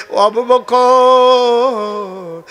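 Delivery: very slow, high, loud stretched call; drawn-out loving call. Acted. The Prophet calling Abu Bakr, a long, high, loving call.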